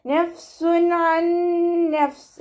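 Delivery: നഫ്സുൻ അൻ with a clear n, chanted high; clear noon, no nasal. Shown as a demonstration. Teacher recites നഫ്സുൻ അൻ clearly for students. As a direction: pronounce നഫ്സുൻ അൻ clearly without ghunna. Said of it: "വ്യക്തമാക്കി മണിക്കാതെ".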